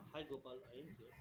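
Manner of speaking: faint, far from the mic; barely audible. Real, not acted. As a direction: voice barely coming through. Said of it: "your voice is low".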